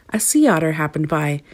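ordinary delivery

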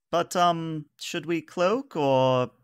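high, hesitant officer voice; Varissa. Acted. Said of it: "says, uh, Varissa".

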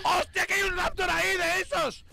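high, slow frightened cry. Real, not acted. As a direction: panic at the raptor. Spotting raptor, frightened.

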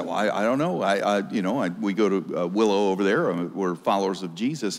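a nervous job applicant, hesitant and hushed; timid mumble. Acted. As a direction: shrink away and stumble over the words. Nervous employee stammers in interview.

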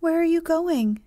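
high, loud, anxious question; crying out with misgiving. A narrator's reading. Wendy sees Peter hurry to the window, cries with misgiving. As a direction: call out in sudden worry. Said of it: "she cried with misgiving".

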